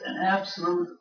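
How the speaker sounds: normal talking voice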